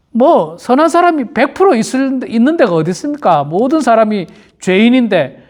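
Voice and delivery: usual speaking voice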